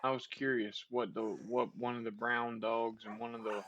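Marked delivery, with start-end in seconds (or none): none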